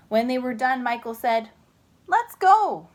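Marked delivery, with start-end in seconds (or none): 2.1-2.9 eager. Acted. Michael urging.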